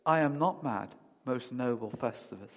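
ordinary talk, nothing marked

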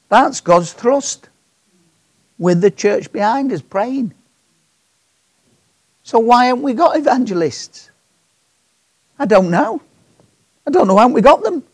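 loud, high appeal to the congregation; fervent preaching. Acted. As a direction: plead with the church, climbing. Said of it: "preaching at full force".

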